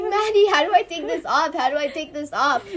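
high, loud mock whine; complaining. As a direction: whine it like a helpless friend. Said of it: "complaining, like".

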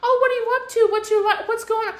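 high, mock-sweet prying voice, laughing; nosy neighbour. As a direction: parody a nosy small-town busybody. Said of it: "They're always up".

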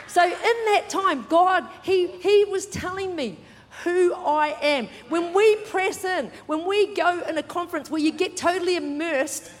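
loud, high, emphatic sermon delivery; fired-up preaching. Real, not acted. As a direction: drive it home to the congregation. Preaches at full force.

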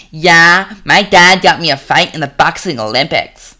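put-on brash young boxer, loud; loud cocky young boxer; Tim's voice. A narrator's reading. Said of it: "called in the C E O's son".

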